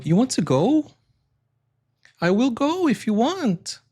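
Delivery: exaggerated sing-song rise; rising Portuguese intonation. Shown as a demonstration. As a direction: push the pitch way up towards each phrase end. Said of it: "we also go up".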